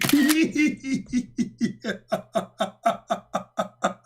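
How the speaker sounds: long high-pitched giggle; squealing laugh trailing out in bursts; drawn-out teasing hee-hee; mocking cackle. Real, not acted. Cackles at own taunt.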